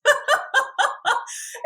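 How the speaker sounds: loud, high giggle; high laugh. Retold or imitated. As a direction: burst into a squealing laugh. Laughing at own mock outrage.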